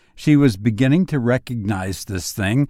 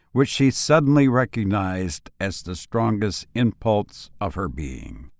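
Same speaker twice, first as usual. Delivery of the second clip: plain reading voice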